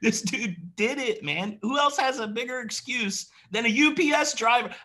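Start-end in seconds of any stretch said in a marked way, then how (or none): 1.6-4.7 fired up. Real, not acted. Fired up, calling out excuses.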